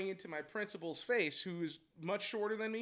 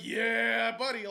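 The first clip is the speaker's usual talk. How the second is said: loud, higher, drawn-out yeah; retold fighting challenge; 4 semitones higher, 9 dB louder, much slower than usual. Coach daring the dad to step up, loud goading. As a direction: goad him on loudly, retelling a fight.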